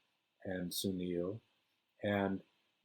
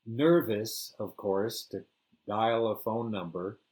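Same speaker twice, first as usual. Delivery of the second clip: ordinary delivery, nothing marked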